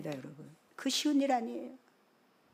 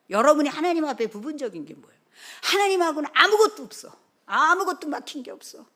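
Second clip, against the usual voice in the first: raised, loud, emphatic sermon voice; forceful preaching; 7 semitones higher, 10 dB louder than usual. Real, not acted. Preacher insisting nothing may block us from God, forceful preaching. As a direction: press the point hard on the congregation.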